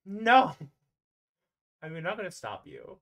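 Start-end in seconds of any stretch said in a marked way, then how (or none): 0.0-0.5 high, loud shocked no; loud protest. Real, not acted. Game master loudly objecting to a player's plan. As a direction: cut them off with a loud no.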